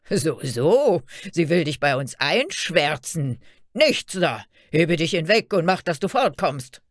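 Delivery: loud, scornful guard's voice; shouted dismissal. A narrator's reading. Guards send Fairy Tale away from the gate, shouting. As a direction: drive her off with loud scorn. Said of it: "So riefen die Wächter untereinander".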